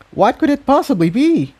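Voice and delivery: high, alarmed little filly; a small pony asking in alarm; young filly voice. Acted. Tiptoe wonders.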